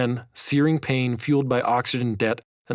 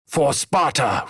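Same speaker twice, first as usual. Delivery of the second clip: slow, higher, louder war cry; battle roar; 4 semitones higher, 4 dB louder, slower than usual. Retold or imitated. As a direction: roar it like a Spartan before battle. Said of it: "we roared".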